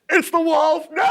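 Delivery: high shrill alarm; alarmed cry. Acted. Pigs realise the sheep is the wolf, alarmed. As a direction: shriek the discovery.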